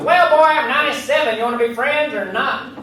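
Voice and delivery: put-on a spry old vet hurrying you up; old veteran. Old veteran on the phone to the comic, high and impatient.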